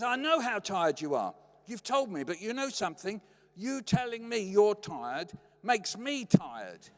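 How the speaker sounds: exasperated retort. Retold or imitated. Mum answering dad's tiredness boast, mock exasperated retort. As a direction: land each word as a comeback.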